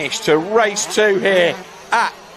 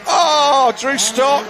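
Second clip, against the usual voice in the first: slow, drawn-out, higher amazement; awed commentator after the finish; stunned disbelief; 5 semitones higher, slower than usual. Real, not acted. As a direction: let the shock sink in.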